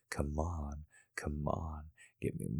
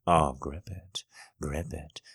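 usual speaking voice

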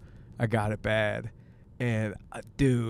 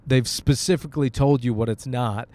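ordinary delivery

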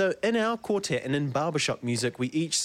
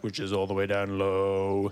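much lower bass register; 7 semitones lower than usual. Shown as a demonstration. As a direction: drop the voice down low like a bass. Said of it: "There's a bass part".

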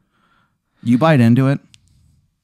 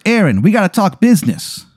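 put-on Italian accent; 9 semitones higher than usual. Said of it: "he talks in an Italian accent".

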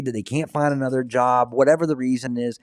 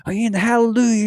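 high, loud hallelujah shout; hammed-up preacher all wound up; excited preacher; 10 semitones higher, 4 dB louder than usual. Preacher running around his church, wound up and excited.

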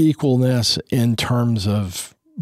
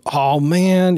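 mock whine, pitched up; a kid's half-hearted groan of protest; whiny child; 8 semitones higher, 3 dB louder than usual. Kid whining instead of obeying, mock whine. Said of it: "instead of whining".

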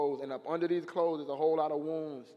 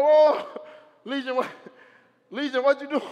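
surprised; 8 semitones higher, 9 dB louder than usual. Acted. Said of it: "And she's like".

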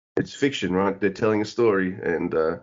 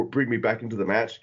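usual speaking voice

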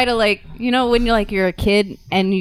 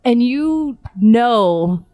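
slow, drawn out; 5 semitones higher, 3 dB louder, much slower than usual. Acted. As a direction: stretch each word slowly. Slowed emphatic line in speech.

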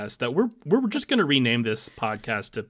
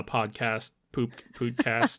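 ordinary talk

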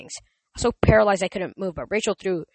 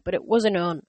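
ordinary delivery

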